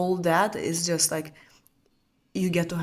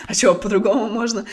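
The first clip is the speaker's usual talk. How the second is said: fast, louder, mock-incredulous Russian; 7 dB louder, faster than usual. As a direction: blurt it out in Russian, amused. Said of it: "I started speaking Russian".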